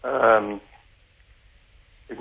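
distant hands-free phone sound; on speakerphone. Real, not acted. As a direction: caller on a speakerphone. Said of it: "es ist immer noch nicht so ganz dolle".

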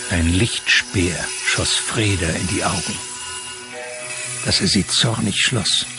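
ordinary talk

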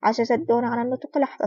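usual speaking voice

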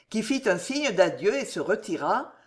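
ordinary delivery

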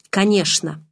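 mildly annoyed, abrupt reply; understated sharp annoyance; curt, irritated. A narrator's reading. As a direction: cut it short with irritation. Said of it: "неожиданно резко и раздражённо сказал он".

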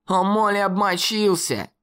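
высокий дразнящий голос хулигана; выкрикнуть с издёвкой, выше обычного; насмешливый крик. A narrator's reading. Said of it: "закричал Салли". (дразнящий выкрик хулигана)

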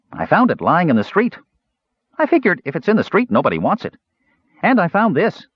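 put-on excited kid showing off his finds; eager Kender. Earwig showing the twins his treasures, high eager chatter.

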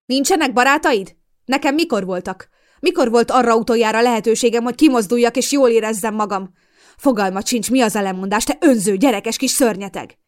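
loud, high furious tirade; raging. Acted. Mother rages at her selfish daughter, loud and high. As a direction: work yourself into a rage. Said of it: "valósággal őrjöngött".

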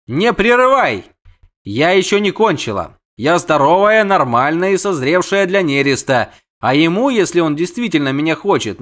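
high, indignant siren ultimatum; insistent siren. Acted. Siren finishes her demands to the suitor, insistent.

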